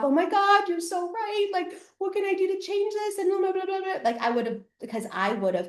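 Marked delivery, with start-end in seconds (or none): 0.0-4.0 much higher, mock tearful pleading; old self bawling. Old school [name] pleading with the ex, mock tearful. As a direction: imitate her old weepy self. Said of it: "old school [name], bawling my face off".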